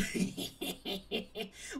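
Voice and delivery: breathy, voiceless chuckle; long wheezy run of giggles; laughing. Real, not acted. Streamer amused by own Kool-Aid dojo joke, laughing.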